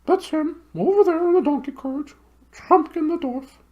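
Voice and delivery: very high hooting owl voice, far from the narrator's own; high, hooting bird voice. Acted. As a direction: hoot it like a big owl.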